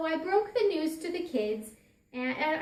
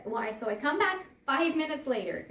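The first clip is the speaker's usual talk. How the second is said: ordinary delivery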